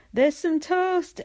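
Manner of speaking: attempted Geordie accent, high and slow; a half-hearted Geordie accent stab; failed Geordie accent. Quoting Chloe in an attempted Geordie accent. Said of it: "I can't do Geordie accent".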